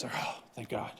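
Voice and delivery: whispered sigh of relief, imitating the market; a hushed mock thank God; mock relieved whisper. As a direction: breathe it out like relief at last. Market narrative voiced as relief.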